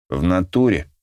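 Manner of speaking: lightly voiced gangster agreement; a hint of street thug slang; russian street thug. A narrator's reading. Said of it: "поддакнул я и решил, что перебарщиваю".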